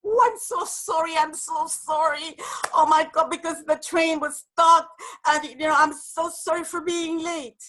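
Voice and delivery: high, hurried, over-apologetic gush; flustered apologetic presenter. Retold or imitated. Woman late for her presentation, gushing apologies to the room. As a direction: play the frazzled latecomer begging forgiveness.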